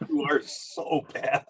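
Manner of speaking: breathless, giggling reproach; laughing scold. Retold or imitated. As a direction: say it through a laugh. Manager laughing while speaking.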